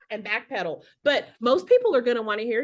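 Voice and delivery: ordinary delivery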